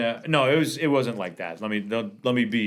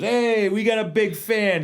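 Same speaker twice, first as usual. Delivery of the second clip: imitating De Niro; 10 semitones higher, slower than usual. De Niro voiced in the story.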